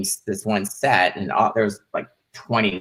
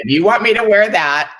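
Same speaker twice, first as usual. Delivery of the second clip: mock insistence; 10 semitones higher, 6 dB louder than usual. Her insisting.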